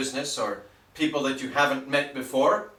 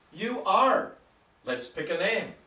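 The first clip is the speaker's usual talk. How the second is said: usual speaking voice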